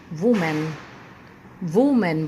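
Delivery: वुमेन said "VOO-men"; common mispronunciation of women. Shown as a demonstration. Teacher quoting what many people say, "VOO-men". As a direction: start वुमेन with an oo vowel. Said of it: "बहुत सारे लोग इसे".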